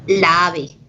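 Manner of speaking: "la ave" with both a's sounded separately and firmly; both A's pronounced. Shown as a demonstration. Teacher demonstrating a clash to students, "la ave" with both vowels voiced. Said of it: "tiene la fuerza de voz en la primera A".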